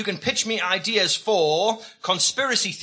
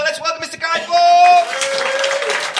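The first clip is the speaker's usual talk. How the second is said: slow, much higher and louder shout; big introduction; 9 semitones higher, 8 dB louder, much slower than usual. Acted. Host announcing the guest to the crowd, drawn-out shouting. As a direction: bellow the welcome like a ring announcer.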